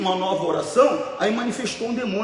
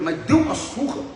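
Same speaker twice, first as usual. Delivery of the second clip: usual speaking voice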